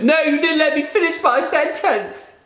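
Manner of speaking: high, slow mimicking of co-host; mocking whiny voice. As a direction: parrot her protest in a silly voice. Mimicking his co-host's protest.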